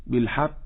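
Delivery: بالحق with breath after the final consonant, "-khi"; qaf turned kaf. Shown as a demonstration. Teacher says listen to the error, qaf turned kaf. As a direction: soften the ق of بالحق into an aspirated k. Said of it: "هذه ليست قافا".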